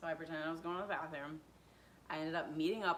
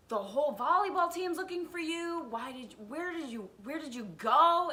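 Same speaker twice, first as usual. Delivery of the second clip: higher, louder imitation of an upset dad; acted demanding dad; 8 semitones higher, 5 dB louder than usual. Dad confronts missing daughter, acted high-pitched demanding. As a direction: demand answers like a frantic parent in a retelling.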